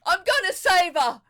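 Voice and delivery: loud, high shout from the water; father shouting from the water to his children. Acted. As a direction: yell it back to the kids while swimming. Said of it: "he shouted to us from the water".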